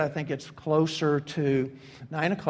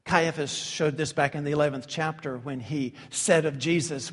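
usual speaking voice

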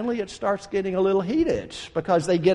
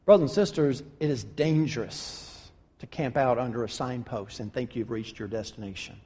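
much lower and softer, grave; solemn warning; 9 semitones lower, 6 dB quieter than usual. Real, not acted. Preacher warning the congregation, low and grave. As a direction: drop the voice to warn the flock.

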